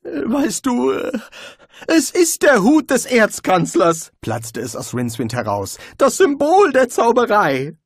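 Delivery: frightened wizard blurting out a secret; nervous wizard. A narrator's reading. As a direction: blurt it out, anxious and high. Rincewind voiced by narrator.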